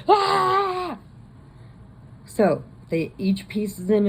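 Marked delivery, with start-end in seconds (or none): 0.1-1.0 high, loud shriek of fright; the cremation guy, shrieking in terror; terrified scream. Retold or imitated. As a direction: scream as a hand grabs your leg. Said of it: "and he's like".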